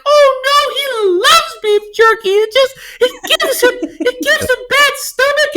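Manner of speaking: imitated mother, whiny squeaky falsetto; ridiculous high-pitched voice. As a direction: plead in her very high put-on voice. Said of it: "she has this ridiculous voice".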